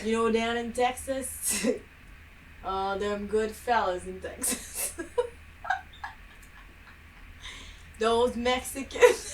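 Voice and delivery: slow, laughing fake Texan accent; attempted Texan accent. Friend plays a Texan character, laughing through it. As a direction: drawl it like a Texan, cracking up. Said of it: "Just the accent was off".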